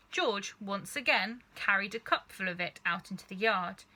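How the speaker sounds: usual speaking voice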